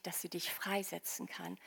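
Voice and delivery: whisper. Real, not acted. Sermon, intimate point about truth.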